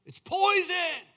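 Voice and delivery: high, loud warning cry; alarmed shout. Retold or imitated. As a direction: yell it as if you just tasted poison.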